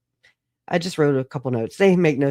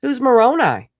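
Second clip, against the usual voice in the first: higher, louder baffled questions; 9 semitones higher, 7 dB louder than usual. Retold or imitated. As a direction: sound totally lost and amazed. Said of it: "I was like".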